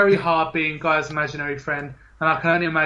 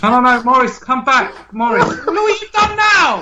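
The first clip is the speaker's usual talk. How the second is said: shout; 9 semitones higher, 7 dB louder than usual. Acted. Guest storms off, host calls.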